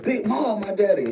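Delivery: high-pitched, indignant retort; mock aggressive. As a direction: imitate a son angrily objecting. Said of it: "Like, he got aggressive".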